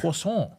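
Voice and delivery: "Croissant" high, with a French nasal ending; perfect French pronunciation. Retold or imitated. Amazed teammate echoes Gaz's order, "Croissant" in perfect French. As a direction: marvel at "Croissant" said as French "kwa-son". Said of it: "it was fucking perfect".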